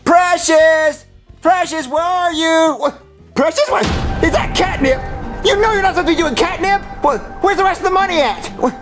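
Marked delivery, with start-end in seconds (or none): 0.0-2.8 drawn-out call for a pet. Acted. Owner looks for the missing cat, calling out. 5.4-8.4 fast, raised-pitch telling-off; scolding. Acted. Owner catches the cat with catnip, scolding.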